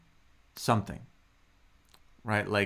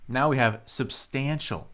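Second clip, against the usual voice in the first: usual speaking voice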